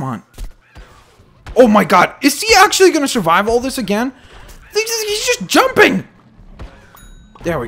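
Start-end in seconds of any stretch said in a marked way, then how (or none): 1.6-6.1 loud, high incredulous cry; real frantic gamer exclamation; exasperated disbelief. Real, not acted. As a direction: climb higher in disbelief as you yell. Enemy keeps surviving.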